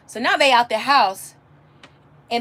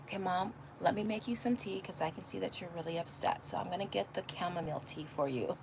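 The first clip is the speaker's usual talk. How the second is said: much softer, gentle imitation of her son; son's calm voice; 3 semitones lower, 16 dB quieter than usual. Mom imitating her son offering chamomile tea, soft and gentle. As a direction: quietly mimic a caring son offering tea. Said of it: "my son goes".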